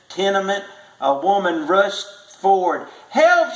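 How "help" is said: high, loud scream for help; mother screams. A narrator's reading. Mother screaming for help at the burning tenement, high loud cry. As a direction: scream it out in panic. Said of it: "she screamed".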